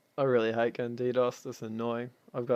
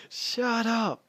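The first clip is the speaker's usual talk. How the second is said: sudden much higher yelled command; shouted order; 12 semitones higher, 4 dB louder than usual. Retold or imitated. Clan leader yells at everyone talking at once, loud. Silencing a chattering meeting.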